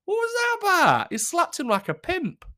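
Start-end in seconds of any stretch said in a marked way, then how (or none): 0.1-1.1 much higher, louder "What was that about?"; incredulous repeat. Real, not acted. Repeating the question to viewers, high and incredulous.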